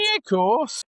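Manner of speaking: very high-pitched mock Scouse; Scouse accent. Friend joining in the Gerrard phone-call bit, squeaky mock Scouse. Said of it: "I'm not gonna do a Scouse accent".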